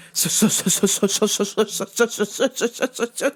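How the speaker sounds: stuttering build-up to a high, drawn-out exclamation; mock stunned stutter. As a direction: stammer on the first sound, then blare the number slowly.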